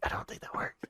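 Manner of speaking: hushed aside, breathy and voiceless; a muttered whisper. Acted. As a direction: whisper it under your breath.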